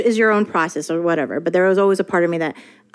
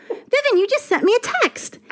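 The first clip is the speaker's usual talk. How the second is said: mimicking writer. Voicing the writer.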